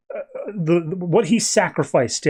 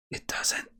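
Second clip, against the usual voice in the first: breathy whisper under the breath; whispered aside; 15 dB quieter than usual. Real, not acted. To the audience, a quick whispered aside. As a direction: drop to a secret whisper. Aside after reading the ending.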